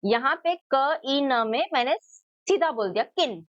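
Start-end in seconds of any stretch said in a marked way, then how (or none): 3.1-3.4 "किन" said straight with a short i; short ई, not drawn out. Shown as a demonstration. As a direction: keep the vowel in "किन" brief. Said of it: "थोड़ा खींच कर नहीं बोला".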